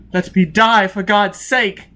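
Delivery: high, loud and slow, pleading for death; desperate begging. A narrator's reading. Heartless man on the table begs Foyle, anguished plea. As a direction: beg in agony.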